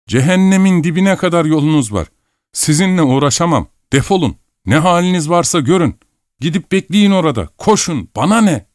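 high-pitched, exasperated dismissal; angry outburst. Acted. Young man fed up with the meddler, angry outburst. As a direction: send them packing, pitched right up.

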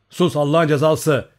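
angry gritted-teeth shout; shout through gritted teeth. A narrator's reading. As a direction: curse them loudly, teeth clenched. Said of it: "diye bağırdı dişlerini sıkarak".

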